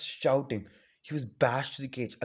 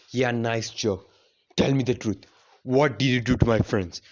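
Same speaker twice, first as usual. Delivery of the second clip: scornful then demanding; 8 dB louder than usual. Acted. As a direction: scoff at the joke, then demand the truth. Naruto scoffs then demands.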